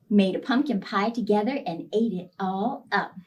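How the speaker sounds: ordinary delivery, nothing marked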